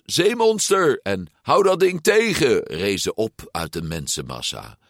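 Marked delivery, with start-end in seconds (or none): 0.0-1.0 high shouted alarm; crowd cry. A narrator's reading. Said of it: "Kreten als". 1.4-2.6 high, loud shouted order; crowd cry. A narrator's reading. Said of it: "Kreten als".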